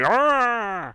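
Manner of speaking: pained grunt of a hit man; short hurt groan; staggering groan. Retold or imitated. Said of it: "he kinda staggers a little bit, like".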